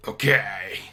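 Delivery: emphatic loud okay. Real, not acted. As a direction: punch out the okay.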